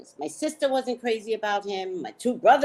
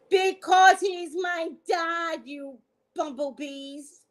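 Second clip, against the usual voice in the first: defiant retort; 8 semitones higher, 4 dB louder, slower than usual. Real, not acted. Retorting to doubters.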